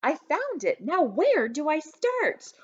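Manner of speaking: excited. A narrator's reading. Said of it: "excited to tell her".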